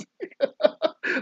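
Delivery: high burst of laughter; laughing. Real, not acted. Dad cracking up at his own joke, high laugh. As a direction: laugh at your own pun.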